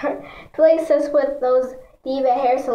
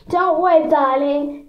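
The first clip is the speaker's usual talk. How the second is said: fake French salon owner; 3 semitones higher, 4 dB louder than usual. A narrator's reading. Salon owner soothes her nervous customer, lightly voiced fake French. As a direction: reassure with a touch of mock French.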